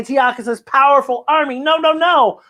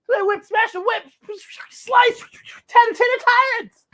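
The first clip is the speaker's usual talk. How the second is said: excited. A narrator's reading. Excited battle retelling, high.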